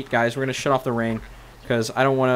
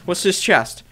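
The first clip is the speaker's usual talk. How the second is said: ordinary delivery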